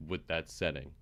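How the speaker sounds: normal talking voice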